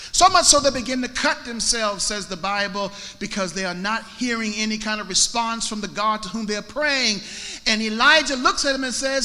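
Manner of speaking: high, loud pulpit fervour; full-force preaching. Acted. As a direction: preach it boldly with the voice lifted.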